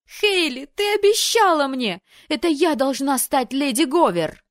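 high, loud shrieking complaint; betrayed shriek. Acted. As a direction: scream that she promised. Sister screams at betrayal.

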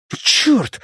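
high, sharp outburst; startled high curse; shocked curse. Acted. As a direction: blurt it as the shot lands. Friend shot beside him.

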